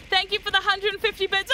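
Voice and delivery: ordinary talk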